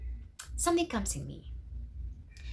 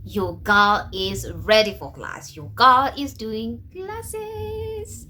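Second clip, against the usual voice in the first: slow, louder, drawn-out boast; triumphant; 6 dB louder, much slower than usual. Real, not acted. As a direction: savor the win, slow and proud. Triumphant announcement.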